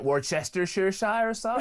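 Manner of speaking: mock mispronounced "Worcestershire", every syllable sounded out; American mispronunciation. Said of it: "their total inability to pronounce Worcestershire sauce".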